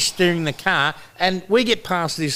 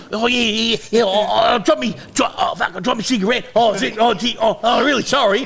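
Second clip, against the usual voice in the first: flustered apology; 7 semitones higher than usual. Retold or imitated. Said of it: "He goes".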